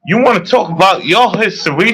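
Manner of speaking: ordinary delivery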